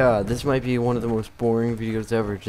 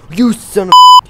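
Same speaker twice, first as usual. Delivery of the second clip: explosive, high-pitched outburst; furious yell; 13 semitones higher, 19 dB louder than usual. Real, not acted. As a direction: scream it in outrage, very loud. Someone took his spot.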